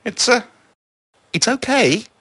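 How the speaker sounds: high, loud, halting reassurance; flustered call to reassure; calling after them. A narrator's reading. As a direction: raise your voice, searching for words. Calling after fleeing visitors.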